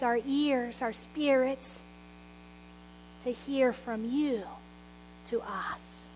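soft, hushed opening prayer. Acted. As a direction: pray quietly and earnestly to God. Opening prayer before the sermon.

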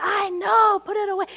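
acted irritated snap on the first words, then easing off; fed up, brushing someone off; mad. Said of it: "And Will gets mad. He's like".

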